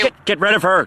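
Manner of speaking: first, forceful call to kill the clip; insistent order. Real, not acted. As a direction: bark it at the producer. Repeats order to cut the clip.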